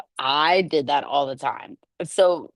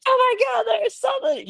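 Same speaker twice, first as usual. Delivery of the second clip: very high mock fright; fake scream. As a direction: cry out to frighten her. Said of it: "I'm like".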